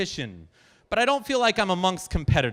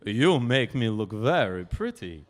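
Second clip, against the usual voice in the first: put-on lower, slow Polish-accented lady; lowered Polish accent, unhurried; 7 semitones lower, slower than usual. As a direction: play the grand Polish noblewoman, slowly. Noble Polish lady praising portrait.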